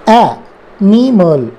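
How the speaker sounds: drawn-out teaching read of एनिमल; slow phonics demo. Shown as a demonstration. Teacher sounding out एनिमल for learners, very slow. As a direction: stretch एनिमल out very slowly, opening ए like the a in cat. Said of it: "तो ये ए फिर से sound आ गया".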